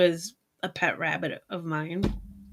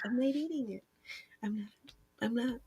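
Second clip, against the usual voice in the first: put-on softer, stubborn child refusing food; quiet refusal; 2 semitones higher, 13 dB quieter, faster than usual. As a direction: murmur it, refusing to budge. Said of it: "And I'd be like".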